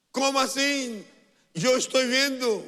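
protesta actuada, aguda y fuerte; réplica alarmada. Retold or imitated. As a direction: replicar a gritos como el criado asustado. Criado alarmado replica al profeta. (grito de incredulidad actuado)

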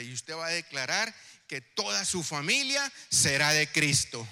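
loud fervent preaching; preaching at full force. Acted. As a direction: rouse the congregation, full voice. Calls for applause, preaches.

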